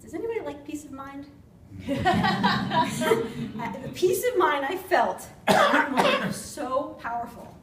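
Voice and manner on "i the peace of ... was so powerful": higher and louder, laughing, slowly; real relief bubbling up into laughter; relieved laughter. Real, not acted. Relief after the cancer scare.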